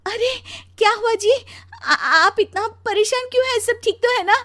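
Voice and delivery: high, loud, anxious questioning; alarmed wife. Acted. पत्नी रोते पति से घबराकर पूछती. (घबराई ऊँची आवाज़ में पूछना)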